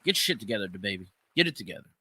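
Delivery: ordinary delivery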